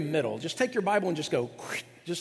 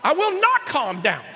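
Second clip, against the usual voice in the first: high, loud mock indignation; mock outraged wife; 7 semitones higher, 8 dB louder than usual. Wife told to chill, hammed-up loud high outrage. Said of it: "women that were going".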